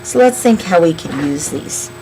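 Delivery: ordinary delivery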